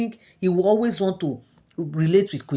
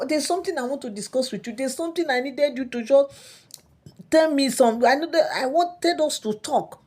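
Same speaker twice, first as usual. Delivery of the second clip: king's complaint; 6 semitones higher than usual. Retold or imitated. King scolds his wife at length.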